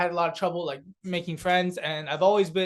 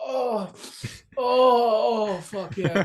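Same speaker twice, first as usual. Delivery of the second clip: high, drawn-out moans of pleasure; hammed-up ecstatic relief; mock drug rush; 5 semitones higher, 4 dB louder, much slower than usual. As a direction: moan as if the high just hit. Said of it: "it's just like taking drugs".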